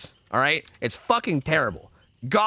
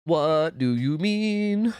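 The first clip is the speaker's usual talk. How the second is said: very slow, stretched mock question; slow, teasing repeat at chat; drawn-out mock disbelief; much slower than usual.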